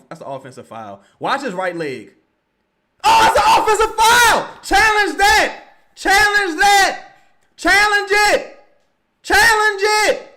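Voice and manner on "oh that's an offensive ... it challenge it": very high outraged scream; wrestling commentator. Real, not acted. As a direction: scream at the bench to challenge. Screaming for a challenge.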